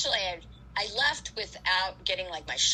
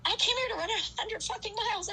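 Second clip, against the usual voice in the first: high, indignant insistence; high-pitched mock outrage at being told to stop; defiant; 13 semitones higher than usual. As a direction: swear it out in stubborn disbelief. Defiant refusal to quit race.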